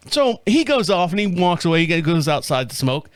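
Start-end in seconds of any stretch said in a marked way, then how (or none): none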